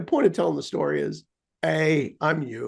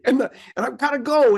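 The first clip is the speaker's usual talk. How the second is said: frustrated protest; 8 semitones higher than usual. Retold or imitated. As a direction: protest at the machine, rising in frustration. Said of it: "It's like".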